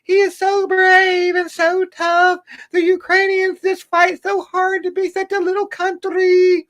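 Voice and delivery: put-on very high, slow, breathy-falsetto girl gushing; gushing TikTok girl. Mocking a TikTok girl gushing over Ukrainian soldiers. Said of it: "some girl on there, on TikTok going".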